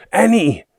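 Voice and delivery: high, loud cry for help; terrified yell. A narrator's reading. Boy tugged from the rock, yelling for the girl. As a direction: scream her name in terror. Boy grabbed while climbing.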